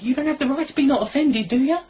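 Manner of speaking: high, pleading incredulous retort; high-pitched disbelief at a stubborn crowd; bewildered high protest. Retold or imitated. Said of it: "I'm like".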